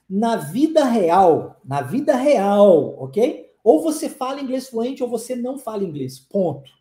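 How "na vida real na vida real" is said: Na vida real drawn out slowly and louder; slow emphatic stress. Shown as a demonstration. Teacher hammering a point home to students, slow and emphatic. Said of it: "emphatic repetition, slowed".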